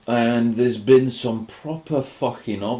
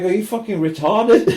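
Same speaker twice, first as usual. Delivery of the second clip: incredulous friend; 10 semitones higher, 7 dB louder than usual. Retold or imitated. Friend scolding him, retold.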